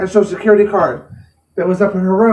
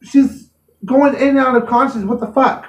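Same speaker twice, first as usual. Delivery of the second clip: exasperated outburst; 5 semitones higher than usual. Retold or imitated. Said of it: "and I'm like".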